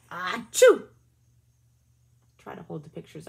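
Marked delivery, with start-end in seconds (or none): 0.1-0.9 big hammed-up achoo; loud mock sneeze. Reading to kids, the cow's loud sneeze. Cow sneezes in read story.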